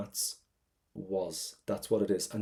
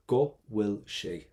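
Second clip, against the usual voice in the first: slow model phrase; much slower than usual. Shown as a demonstration. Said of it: "What's the difference between go will".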